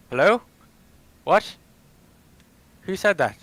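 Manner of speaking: high, loud, alarmed; startled. Pretend. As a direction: call out, suddenly spooked. Player character startled by voice.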